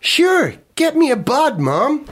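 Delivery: put-on high, breezy American teen; hammy American kid; American accent. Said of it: "You're not American".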